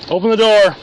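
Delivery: loud barked order; shouted command. Real, not acted. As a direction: yell the order at the car. Officer commands at car door.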